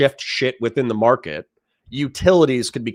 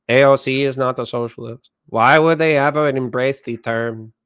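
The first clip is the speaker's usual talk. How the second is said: put-on sleepy Mexican accent. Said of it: "I'll do the sleepy Mexican accent".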